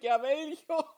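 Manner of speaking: shouted reply. Retold or imitated. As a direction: yell back cheekily. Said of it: "schreit ich zurück". (frech zurückgerufen)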